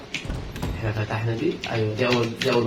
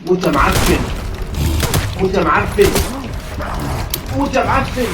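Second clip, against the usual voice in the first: loud, rising trash-talk shouts; aggressive taunt; 5 semitones higher, 4 dB louder than usual. Real, not acted. Gamer shooting the monster, aggressive taunting. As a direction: yell it at the enemy as you shoot.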